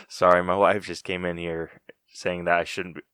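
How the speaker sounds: ordinary talk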